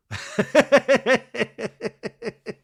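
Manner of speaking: high, loud burst of laughter; long laugh tapering off in bursts; loud cackling laugh. Real, not acted. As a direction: crack up hard, then let it subside. Laughing at the recap.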